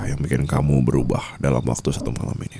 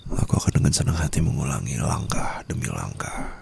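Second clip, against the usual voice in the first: soft threatening whisper; a hushed, sinister threat; menacing whisper; 3 semitones lower, 3 dB quieter than usual. Acted. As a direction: promise it in their ear. Threatening to choke again.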